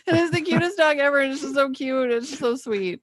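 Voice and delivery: high, cooing gush over a cute dog. Real, not acted. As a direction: coo over the sweetest dog. Gushing over dog story.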